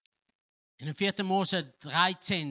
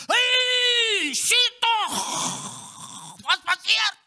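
much higher, slow, shouting astonished king; 8 dB louder, much slower than usual. Acted. Nebukadnezar cries out at the four men, astonished shout. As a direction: cry it out in disbelief.

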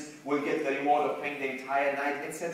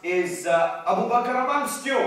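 raised, loud calling question; screamed out; 7 semitones higher, 6 dB louder than usual. Acted. Enemy leader asks after the Prophet's companion, calling out loudly. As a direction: call it up to someone far off. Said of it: "He screamed out".

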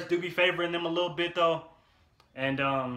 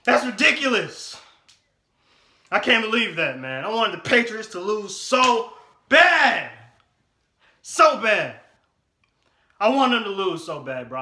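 loud, higher, slow fuming rant; angry rant; 8 semitones higher, 12 dB louder, slower than usual. Real, not acted. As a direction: vent it out, stretched and furious. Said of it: "I'm so mad right now".

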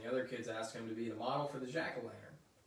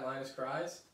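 plain reading voice

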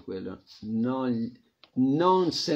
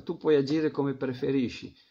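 ordinary delivery

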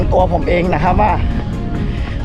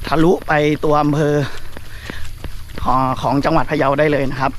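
talking while jogging; a bit winded from running. Real, not acted. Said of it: "ก็วิ่งไปคุยไปเนาะ".

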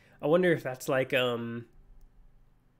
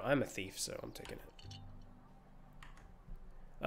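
sly whisper; 4 semitones lower, 15 dB quieter than usual. Pretend. Sneaky thief aside.

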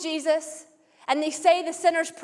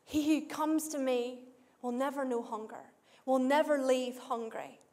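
much softer, low and intimate; hushed quote; 3 semitones lower, 9 dB quieter than usual. Acted. Preacher quoting Jesus' promise to the congregation, hushed. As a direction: drop almost to a hush for the promise.

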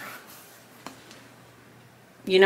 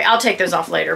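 ordinary talk, nothing marked

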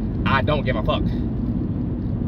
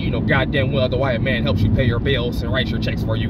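normal talking voice

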